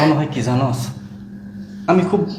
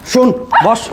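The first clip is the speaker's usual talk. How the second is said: sharp raised-pitch command; angry order; 7 semitones higher, 4 dB louder than usual. Acted. Husband orders his wife to listen, angry command. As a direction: order her curtly, pitch jumping high.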